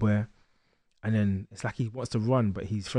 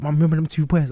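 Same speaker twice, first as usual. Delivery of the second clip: louder, higher retold excitement; excited; 5 semitones higher, 7 dB louder than usual. Boy telling his mum about the fight, excited. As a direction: burst out with news for mum, re-enacted. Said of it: "I was excited".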